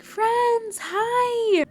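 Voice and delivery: very high, sing-out hello; delighted greeting. Retold or imitated. Founder recognizing the visitors, imitated delighted greeting. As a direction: imitate someone thrilled to recognize friends.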